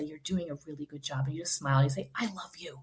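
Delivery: hushed, loving encouragement; tender reassurance. Acted. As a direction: gently reassure a tearful child. Mother reassuring her struggling child.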